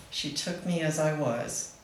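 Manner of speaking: ordinary talk, nothing marked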